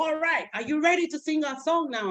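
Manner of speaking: high bright question; teacher voice. Shown as a demonstration. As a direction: ask the kids cheerfully. Teacher to kids.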